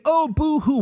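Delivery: high mock whine; mock crybaby boo-hoo; mock whining. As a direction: whine it like a sore loser.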